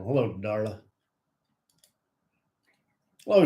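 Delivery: usual speaking voice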